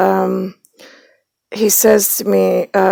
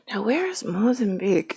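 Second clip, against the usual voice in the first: whispered wondering; 4 semitones higher, 11 dB quieter than usual. Retold or imitated. Wondering aloud to herself.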